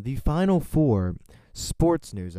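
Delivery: loud, punchy sports news opener; sportscaster announcement. Pretend. Host launching sports news to everyone, loud announcer call.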